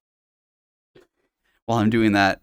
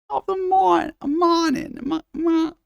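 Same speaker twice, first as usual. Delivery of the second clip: high falsetto Irish, faltering on morning; Irish accent attempt; slower than usual. Shown as a demonstration. Streamer loses the Irish accent, high and faltering. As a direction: stumble through the brogue, high and thin. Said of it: "you get lost in the moi".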